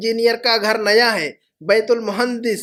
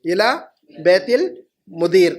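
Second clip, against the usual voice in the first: word by word. Shown as a demonstration. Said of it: "मुदीर के घर तक इला बैतिल मुदीर".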